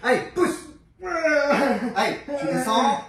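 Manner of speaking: loud high urging; coach shouting. Real, not acted. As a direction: shout to push the last reps. Coach urging the last reps.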